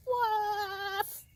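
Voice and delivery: high, long-drawn bird's attempt at a woof; shrieked woof. Little bird trying to find a friend, high shrieked woof. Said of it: "and shrieked".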